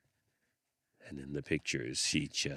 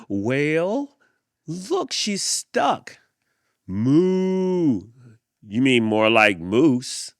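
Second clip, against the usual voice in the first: put-on much higher, louder, slow jeering kid voices; taunting kids; 10 semitones higher, 15 dB louder, much slower than usual. A narrator's reading. Playground kids jeer at the stuck girl, loud and high. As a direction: sneer it out like mean playground kids.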